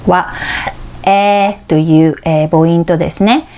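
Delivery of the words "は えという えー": usual speaking voice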